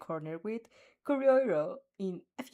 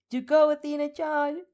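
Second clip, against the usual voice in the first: higher and louder, a bright cheer; 7 semitones higher, 7 dB louder than usual. A narrator's reading. Uraraka cheering Athena on before the fight, bright and loud. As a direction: throw an excited cheer at her. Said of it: "Uraraka cheers".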